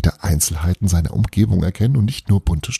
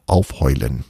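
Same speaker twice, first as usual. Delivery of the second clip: plain reading voice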